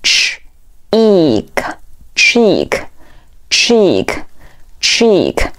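"ch", pause, "eek", then whole "cheek" slowly; sounding out, then blending. Shown as a demonstration. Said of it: "组合在一起". (先拆读ch、eek，再慢速拼读cheek)

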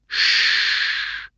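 a whispered hush. A narrator's reading. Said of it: "said Holmes".

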